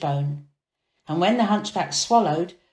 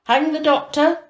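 loud, higher verdict; stern command; 8 semitones higher, 6 dB louder than usual. Acted. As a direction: order it from the bench. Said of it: "said the governor".

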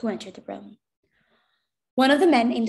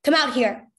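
louder officer's command; firm, raised-voice order; 3 semitones higher, 6 dB louder than usual. A narrator's reading. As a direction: summon them with authority. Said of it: "he ordered".

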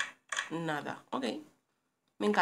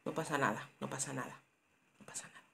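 fading reassurance; 3 semitones lower, 7 dB quieter than usual. Real, not acted. Repeating to self, fading out.

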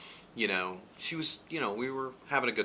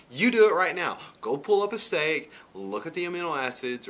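higher and louder, insistent command; emphatic challenge; 8 semitones higher, 7 dB louder than usual. Retold or imitated. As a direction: push her to go check it. Challenging her to check.

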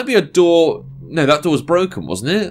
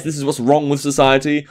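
ordinary talk, nothing marked

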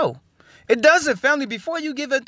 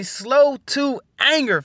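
slow, higher, stretched words; drawn-out emphasis; 4 semitones higher, much slower than usual. Real, not acted. Preacher listing what the family practices, slowly drawn out. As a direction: stretch each word for weight.

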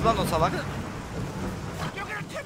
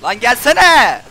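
slow, louder come on then; frustrated challenge; 2 semitones higher, 8 dB louder, much slower than usual. Real, not acted. Gamer daring the boss to come, slow loud challenge. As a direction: dare the enemy to approach.

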